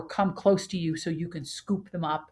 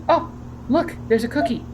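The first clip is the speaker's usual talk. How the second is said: excited dog voice; 6 semitones higher, 4 dB louder than usual. Retold or imitated. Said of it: "the dog goes".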